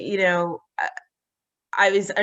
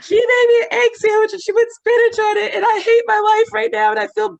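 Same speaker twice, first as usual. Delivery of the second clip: high, loud wailing sob-talk; hysterical crying; 8 dB louder than usual. Retold or imitated. To her husband, high loud mock sobbing over a sandwich. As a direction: blubber it out, half laughing, half crying. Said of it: "So now we're hysterically crying".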